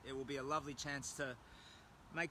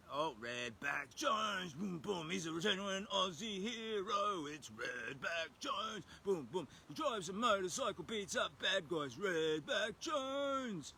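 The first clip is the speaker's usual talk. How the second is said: slow, higher movie-trailer hype for an action hero; hero announcer; 6 semitones higher, slower than usual. Pretend. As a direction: sell him like a cheesy action hero trailer. Hyping a hero character.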